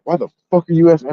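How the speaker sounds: high, loud and fast; retold fury at a GameStop clerk; angry outburst. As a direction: fire it back at the nosy clerk. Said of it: "I lost it on the person".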